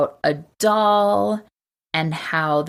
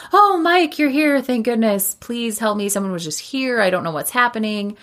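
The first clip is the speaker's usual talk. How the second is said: a scared woman clinging to a rescuer; mock panicked plea; 6 semitones higher than usual. Susie begs Mike for help after the intruder, high mock panic.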